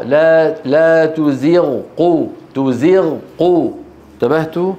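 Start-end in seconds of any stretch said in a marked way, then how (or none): 0.0-3.7 تزغ slowly, full gh separated from قو; clear Ghain. Shown as a demonstration. Teacher drilling students, غ kept distinct from ق. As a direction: hold the غ of تزغ before striking قو. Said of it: "وإنما بين الغين".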